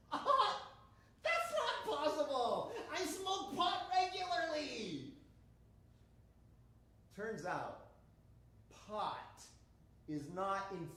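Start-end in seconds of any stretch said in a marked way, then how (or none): 0.1-5.1 high, drawn-out comic protest; hammed-up incredulous denial, pitched way up; mock disbelief. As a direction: protest the news in a slow, high voice. Acted disbelief at cancer diagnosis.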